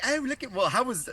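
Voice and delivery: high, mock Cockney character voice; rude, crude Cockney imitation. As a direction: imitate a crude British comedy bloke. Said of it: "the guys from Bottom were like".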